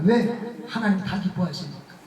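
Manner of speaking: normal talking voice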